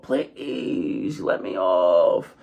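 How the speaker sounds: drawn-out, hammed-up plea; mock begging. Riders begging to get off the Tower of Terror, mock plea. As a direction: stretch out a pretend wail for release. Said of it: "they're screaming and they're crying. And they're begging".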